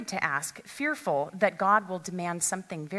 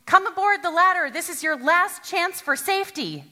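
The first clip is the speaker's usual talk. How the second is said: higher, louder, fast urgent shout; yelling down; 9 semitones higher, 7 dB louder than usual. Acted. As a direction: shout it down from the helicopter. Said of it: "and yelled down".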